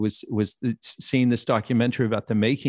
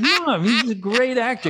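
laughing protest; 11 semitones higher than usual. Retold or imitated. Defending Reed, laughing.

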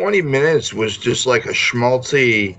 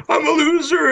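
whiny mock voice. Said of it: "the one guy's like".

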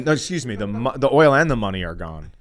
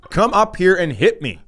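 louder, higher retold challenge; defiant dare; 7 semitones higher, 5 dB louder than usual. Comic daring a heckler's husband, loud and defiant. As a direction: throw it out as a raised-voice dare.

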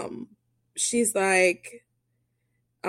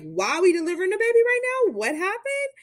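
much higher, fast, alarmed; shocked question; 11 semitones higher, faster than usual. Retold or imitated. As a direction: blurt it out in disbelief. Patient shocked by sudden delivery.

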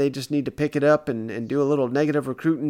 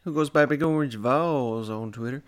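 ordinary talk

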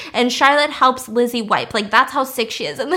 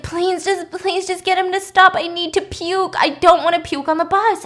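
higher-pitched imitated begging; urgent pleading; 6 semitones higher than usual. Lizzie begging Charlotte to get the bus stopped, urgent pleading. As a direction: beg like you're about to be sick.

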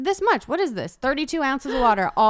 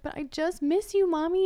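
put-on small, higher pleading child voice; voicing sad son; 6 semitones higher than usual. Mom voicing her sad son, high and pleading. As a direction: plead like a sad little boy missing mom.

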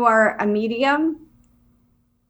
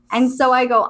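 normal talking voice

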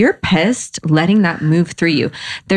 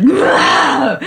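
angry shout; 9 semitones higher than usual. Shown as a demonstration. Venting anger in the kitchen.

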